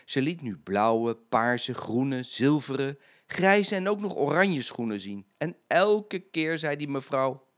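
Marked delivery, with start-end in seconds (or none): none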